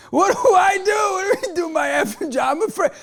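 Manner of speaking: high, loud panicky yelling; panicked fear. Retold or imitated. Driver startled by a yelling stranger, hammed-up panicked shout. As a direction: blurt it out in mock panic, voice cracking. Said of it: "And I was afraid".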